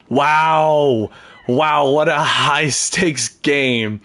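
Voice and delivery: loud, slow amazed exclamation; excited wow. Real, not acted. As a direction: marvel out loud at the game. Exclaiming at the game.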